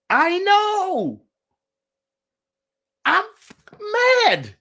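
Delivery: high, loud, exasperated burst; mad, with tense pauses between words; acted-out anger. Acts out being mad.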